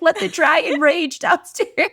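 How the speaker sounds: put-on high, slow, loud child voice; laughing kid imitation; King. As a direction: declare it grandly like a small boy. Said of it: "He's like".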